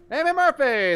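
hailing a fan by name; loud shout-out. Real, not acted. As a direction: call the viewer out loudly. Calling out a viewer's name.

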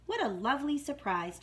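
ordinary delivery, nothing marked

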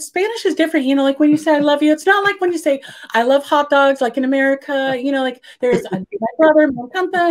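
high, rambling nervous chatter. Retold or imitated. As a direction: ramble on nervously, anything but answer. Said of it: "all chitter chatter".

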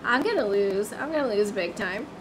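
low, soft mock despair; quiet playful gloom; mock defeated mumble. Real, not acted. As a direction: groan about losing. Losing the minigame.

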